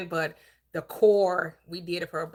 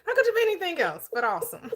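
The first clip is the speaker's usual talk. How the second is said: laughing; 10 semitones higher, 3 dB louder, much faster than usual. Real, not acted. Laughing while praising a guest.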